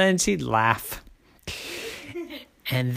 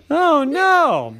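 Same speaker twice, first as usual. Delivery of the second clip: dismayed; 11 semitones higher, 3 dB louder than usual. A narrator's reading. Child sees him hit wall.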